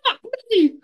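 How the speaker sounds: high, loud exclamation of disbelief; mock exclamation. A Scottish fan after losing the game, high loud disbelief. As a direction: blurt it out high in shock.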